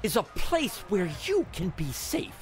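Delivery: halting, emphatic promo with big pitch swings; wrestling promo voice. Acted. Gamer mimicking a wrestling promo about the arena, theatrical. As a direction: deliver a menacing promo, slowing right down at the end.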